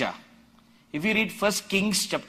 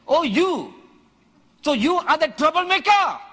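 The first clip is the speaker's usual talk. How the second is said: higher, louder mocking jab at a rival; Ahab mocking; 10 semitones higher, 7 dB louder than usual. King Ahab taunting Elijah on meeting him, higher and louder in mockery. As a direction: sneer it out like a king who is annoyed.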